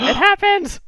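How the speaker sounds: loud, high squeal of excitement; gasping fan excitement; excited outburst. Acted. Mock-excited reaction to scripted kiss.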